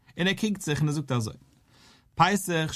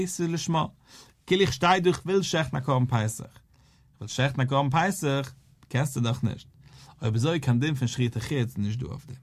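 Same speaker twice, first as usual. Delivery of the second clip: ordinary talk, nothing marked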